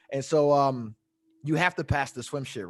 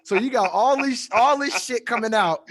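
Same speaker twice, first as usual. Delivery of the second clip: ordinary talk, nothing marked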